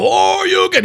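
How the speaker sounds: high mock battle cry; imitating a game character's call; Street Fighter shout. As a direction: yell it like a special move. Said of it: "Akuma doesn't go".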